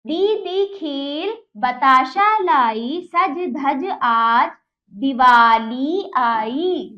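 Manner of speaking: nursery-rhyme recital for a class; children's poem recitation. Shown as a demonstration. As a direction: chant the verse slowly for children.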